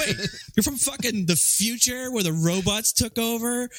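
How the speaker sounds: high-pitched, baffled challenge; mock incredulous. Friend mocking the man from the future, incredulous. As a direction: pile up the disbelief. Acts out mocking disbelief at hero.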